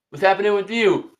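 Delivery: ordinary talk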